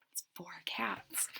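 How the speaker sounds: whisper. Real, not acted. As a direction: whisper it like a secret. Aside whispered to children.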